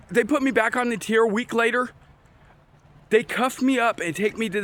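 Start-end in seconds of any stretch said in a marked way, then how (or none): none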